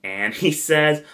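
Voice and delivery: ordinary talk, nothing marked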